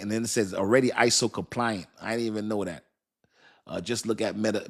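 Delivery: ordinary talk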